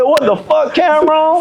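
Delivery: high, outraged what the fuck Cameron; startled outburst. Retold or imitated. Telling about his three-year-old son, high outraged outburst. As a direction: jolt up at the toddler's betrayal.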